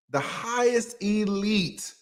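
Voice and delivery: usual speaking voice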